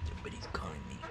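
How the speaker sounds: whispered aside. Real, not acted. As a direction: whisper it to the camera.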